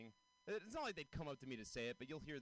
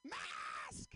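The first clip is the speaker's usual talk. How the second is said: high, hoarse-edged mock scream; harsh, high-pitched yell in imitation; imitated scream. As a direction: scream it as if across a hundred yards. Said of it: "to scream at someone".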